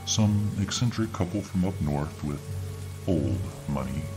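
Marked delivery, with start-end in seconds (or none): none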